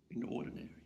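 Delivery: whispered reverent repeat. Acted. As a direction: whisper it like a secret to the room. Echoing the point softly.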